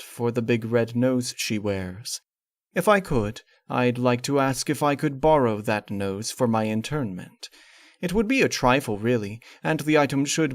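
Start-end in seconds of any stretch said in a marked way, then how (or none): none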